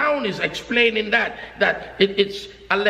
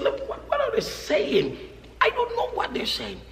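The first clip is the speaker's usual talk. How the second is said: puzzled elder; 5 semitones higher, 6 dB quieter than usual. Retold or imitated. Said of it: "And he said".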